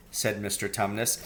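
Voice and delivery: plain reading voice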